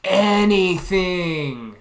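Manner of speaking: "Anything" stretched very slowly; drawn-out plea. Real, not acted. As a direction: drag "Anything" out long and slow. Repeats the wish, stretched out.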